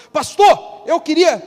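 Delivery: high, loud and eager; excited news. Acted. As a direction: burst out with the good news. Alex arrives eager with news. (animado, voz alta e aguda)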